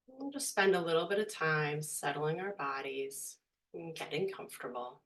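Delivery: hushed, unhurried guiding voice; guided meditation. Real, not acted. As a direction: ease them gently into stillness, leaving space between phrases.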